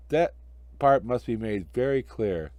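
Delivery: usual speaking voice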